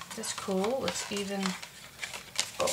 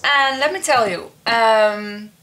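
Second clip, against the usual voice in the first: ordinary talk, nothing marked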